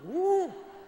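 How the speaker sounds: very high, loud delighted squeal; mock swooning woman. 그런 남자에 반한 여자들을 흉내 내는 목사, 아주 높고 큰 감탄. Imitating women swooning over him.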